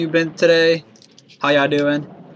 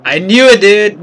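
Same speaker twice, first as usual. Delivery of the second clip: excited; 4 semitones higher, 6 dB louder than usual. Real, not acted. Guessed the character right.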